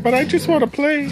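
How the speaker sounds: high, whiny puppy voice for the dog; dog's voice. Retold or imitated. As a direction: speak for the dog like an eager pup. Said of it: "he's like".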